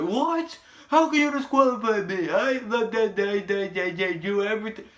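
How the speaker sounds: very high, loud whiny outrage; mock shocked Kevin Owens. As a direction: shriek it like a caught cheater. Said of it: "He was like".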